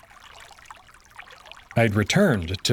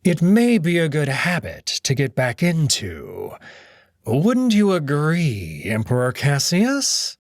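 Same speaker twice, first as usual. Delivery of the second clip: slow, silky mocking host voice; sly Cato; 4 semitones higher, slower than usual. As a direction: purr the welcome with false courtesy, drawn out. Cato needles Cassius.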